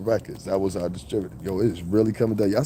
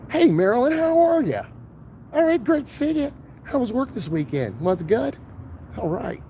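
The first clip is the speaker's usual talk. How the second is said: much higher, chirpy office greeting; code-switching voice; 12 semitones higher than usual. Retold or imitated. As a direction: sweet-talk a coworker, voice jumped way up.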